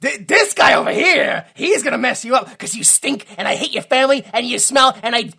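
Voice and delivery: trash talk the crowd like a heel manager; wrestling manager trash talk. Pretend. Manager trash talking the rival, loud heel rant. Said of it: "you just be the mouthpiece".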